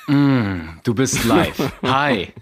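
leicht nachgemachte Politikerstimme; Tobias Hans. Retold or imitated. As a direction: den Politiker kurz nachahmen, locker. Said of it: "spiele ich davor als ersten Ton Tobias Hans". (angedeutete Stimme von Tobias Hans)

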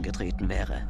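plain reading voice

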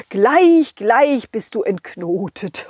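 usual speaking voice

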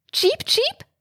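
very high bird chirps; budgie chirp. Acted. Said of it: "begrüßte der Wellensittich". (hohes Wellensittich-Zwitschern)

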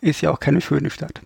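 ordinary delivery

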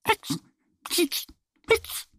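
squeaky little hiccups, one after another; hiccup sounds. A narrator's reading. Little duck hiccupping in her story, high soft hiccups. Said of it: "Die kleine Ente hickst die ganze Zeit".

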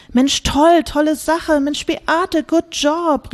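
high, eager praise all at once; excited cheering. Acted. Group cheering Beate's trip idea, high and excited. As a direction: gush with delight over Beate's plan. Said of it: "riefen alle durcheinander".

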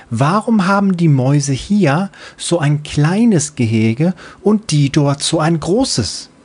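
put-on high, bright young girl's voice; little child voice. Little Freebie replying to her mother, high childlike voice. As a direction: play a sleepy little kid answering mom.

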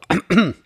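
quick throat clearing. Real, not acted. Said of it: "ich hab irgendwas im Hals". (Räuspern)

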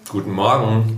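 spricht mit vollem Mund; mouth full. Real, not acted. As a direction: talk through a mouthful. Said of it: "Mit vollem Mund spricht man". (mit vollem Mund)

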